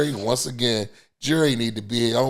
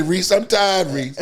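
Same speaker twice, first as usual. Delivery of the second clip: tired catchphrase; 6 semitones higher, 6 dB louder than usual. Retold or imitated. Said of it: "if you ever hear us say".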